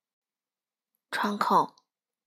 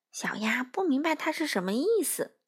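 usual speaking voice

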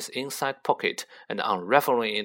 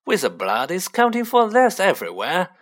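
high, louder unctuous shopkeeper; fawning commiseration, pitched up; oily; 7 semitones higher, 6 dB louder than usual. A narrator's reading. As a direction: ingratiate yourself with a rich customer. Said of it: "in his olive voice".

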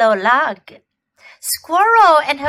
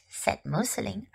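usual speaking voice

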